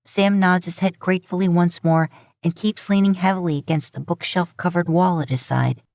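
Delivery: plain reading voice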